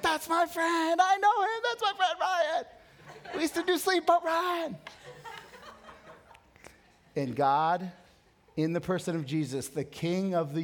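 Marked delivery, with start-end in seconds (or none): 0.0-4.8 put-on proud as a kid showing off his friend; excited bragging. Excitedly telling people the firefighter is his friend.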